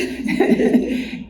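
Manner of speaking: a quick amused laugh; short laugh. Real, not acted. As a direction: laugh lightly at the name. Laugh after remark on church name.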